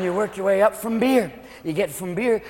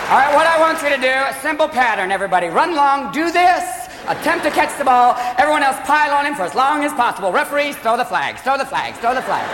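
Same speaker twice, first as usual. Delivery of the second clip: high-pitched, flamboyant choreographer giving orders; theatrical dance director running a practice; ballet choreographer; 5 semitones higher than usual. Acted. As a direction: direct the team like a ballet master. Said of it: "ballet choreographer working with a football team".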